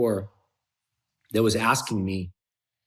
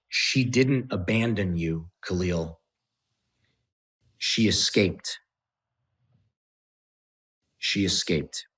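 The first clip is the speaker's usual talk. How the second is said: slow, subdued, long pauses; grave revelation; 3 semitones lower than usual. Retold or imitated. Friend telling him why his girlfriend left, slow and grave. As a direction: let the hard truth land slowly. Friend delivers the hard truth.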